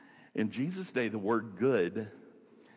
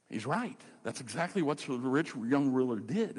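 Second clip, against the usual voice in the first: usual speaking voice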